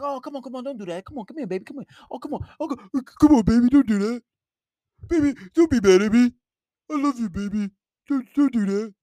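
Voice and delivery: mock a man grovelling to his upset girl; pleading simp. Mocking a boyfriend begging his upset girlfriend, whiny and pleading.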